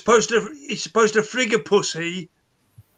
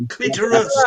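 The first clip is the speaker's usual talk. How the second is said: "Clitoris" pitched up and spelt out firmly; emphatic repeat; 4 semitones higher than usual. Real, not acted. Man telling his mate to get earwax sorted, emphatic. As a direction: announce "Clitoris" to someone not listening.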